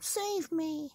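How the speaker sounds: very high desperate cry; screaming. Acted. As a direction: plead while floating away. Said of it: "she screamed".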